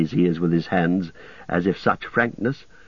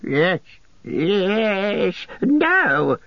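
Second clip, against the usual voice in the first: Gollum shrieking in torment; 11 semitones higher, much slower than usual. A narrator's reading. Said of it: "shrieked Gollum".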